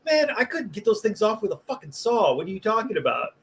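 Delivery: mock exasperated. Retold exasperated reply of coworker.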